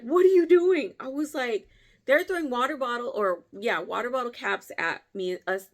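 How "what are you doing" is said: loud, high alarmed question; loud demand. Retold or imitated. Brothers-in-law seeing her climb, loud and high. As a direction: call it out in alarm. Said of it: "and they're like".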